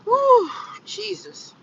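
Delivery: high slow exhale of relief; hot flash. Real, not acted. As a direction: blow it out, overheated. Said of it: "I'm having a hot flash".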